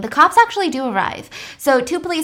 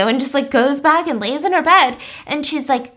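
ordinary talk

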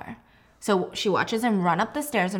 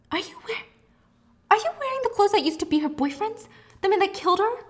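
much higher, appalled question; shocked disbelief; 10 semitones higher, faster than usual. Retold or imitated. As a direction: recoil in horror at the clothes. Trisha shocked at Ethan's clothes.

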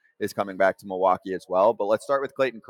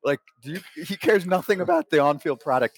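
mock Burnett; 7 semitones higher, 4 dB louder than usual. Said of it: "the way he does the impression".

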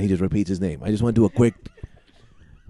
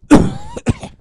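fake cough; 5 dB louder than usual. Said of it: "if you could stop with the coughing".

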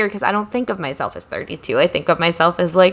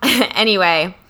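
usual speaking voice

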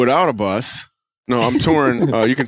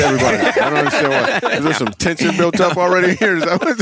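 talking through real laughter; words bubbling with giggles; laughing; 5 semitones higher than usual. Real, not acted. Cracking up, asking why.

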